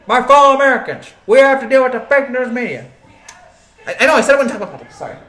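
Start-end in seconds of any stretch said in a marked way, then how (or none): 0.1-2.9 higher, louder speech voice; a hammed-up Trump-style address; mock presidential address. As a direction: orate like a president at the podium.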